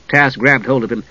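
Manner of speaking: usual speaking voice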